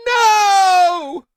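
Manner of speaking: very high, loud crying "No!"; anguished child scream. Acted. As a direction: scream as the pet is taken. Child cries out in despair.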